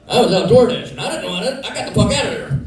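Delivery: real laughter breaking through the words; laughing while talking. Real, not acted. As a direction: giggle your way through the lines.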